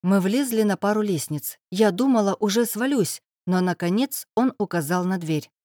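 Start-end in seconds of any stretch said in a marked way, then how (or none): none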